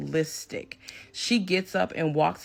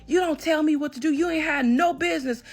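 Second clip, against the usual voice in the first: much higher, louder mock yell; retold yelling; 10 semitones higher, 4 dB louder than usual. Ex yelling at the narrator who told him to stop, high mock yell. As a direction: imitate the ex shouting her down, voice pitched up. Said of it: "starts yelling".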